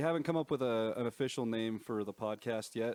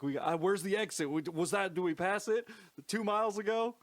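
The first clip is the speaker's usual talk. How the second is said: higher, flustered lost parent voice; panicking parents; 8 semitones higher, 4 dB louder than usual. Retold or imitated. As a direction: imitate confused parents missing the exit. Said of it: "probably panicking harder ... they were like".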